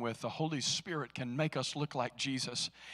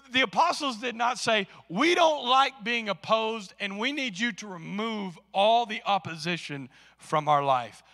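full-force preaching; 9 semitones higher, 9 dB louder than usual. Acted. Pastor rousing the congregation about opposition, full-force preaching.

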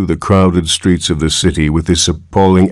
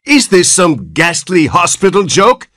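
enraged; 12 semitones higher than usual. Acted. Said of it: "his terror resolving into rage".

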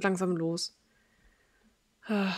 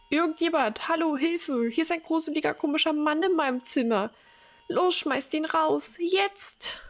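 calling for help; 8 semitones higher than usual. Acted. Frightened old mother shouting down the hall for staff, panicked. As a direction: yell for someone to come throw him out.

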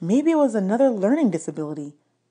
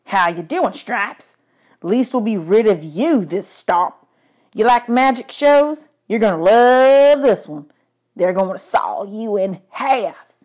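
louder, higher cruel taunt, slow; smirking trucker; 3 semitones higher, 5 dB louder, slower than usual. A narrator's reading. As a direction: gloat over the doomed zebra. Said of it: "He smirked at the zebra".

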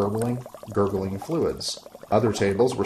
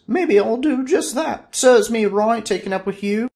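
huffy woman; 13 semitones higher, 6 dB louder than usual. Acted. Woman threatening to leave, very high and loud. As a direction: snap back at him, high.

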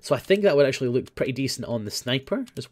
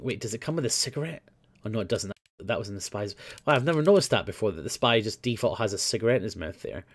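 normal talking voice

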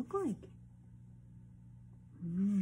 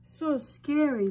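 mock fright; 5 semitones higher, 8 dB louder, slower than usual. Reacting to wolf.

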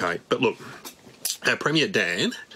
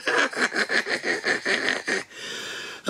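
high breathy puppet giggle; bat giggle; 11 semitones higher, 4 dB quieter than usual. Pretend. Bat puppet laughs at its own joke in a high giggle. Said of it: "The Wuhan bat".

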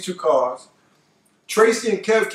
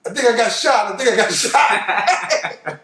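mock panic; 3 semitones higher, slower than usual. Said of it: "Tracy said".

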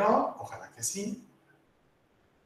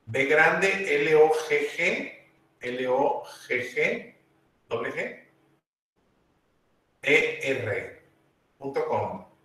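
slow, letter-by-letter spelling; slower than usual. Real, not acted. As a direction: spell it out slowly for students to type. Spelling out a web address. (deletreando despacio)

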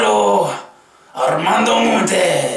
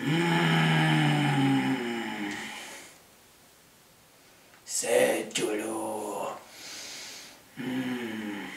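very soft, low and slow, moping; 7 semitones lower, 11 dB quieter, much slower than usual. Shown as a demonstration. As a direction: let it sag into a mournful hum.

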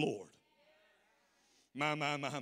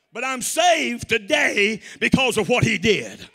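joyful testimony; 6 semitones higher, 12 dB louder, slower than usual. Retold or imitated. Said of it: "the testimony would go".

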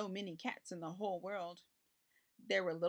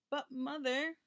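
put-on high, mildly miserable child's protest; lightly voiced unhappy boy, pitched up; 6 semitones higher, slower than usual. A narrator's reading. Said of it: "said Richard miserably".

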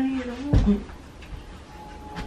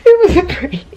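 loud, high, laughing confession; laughing reveal; 8 semitones higher, 13 dB louder than usual. Real, not acted. Prankster to fooled boyfriend, laughing reveal. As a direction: blurt it out through laughter.

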